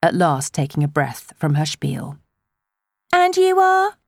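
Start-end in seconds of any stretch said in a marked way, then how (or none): 3.1-4.0 gushing village busybody. A narrator's reading. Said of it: "the woman went on".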